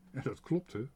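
ordinary delivery

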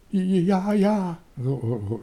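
high, stuttering little yes; stammered lie. A narrator's reading. Boy lying to his friends about rescuing them, high stammer. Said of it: "loog Toontje stotterend".

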